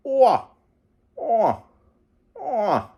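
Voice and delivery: high, falling "Ooh-ah" calls, fading; moose call. Retold or imitated. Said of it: "the moose goes".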